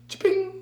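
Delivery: high ricochet cha-ping; ricochet sound. Pretend. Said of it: "You make a ricochet sound".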